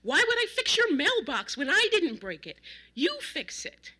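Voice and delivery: high, loud outraged yell; enraged. A narrator's reading. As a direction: explode with indignation. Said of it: "almost purple with rage".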